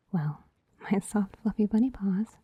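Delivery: ordinary delivery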